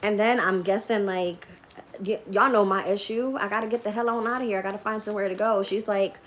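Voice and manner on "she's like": ordinary delivery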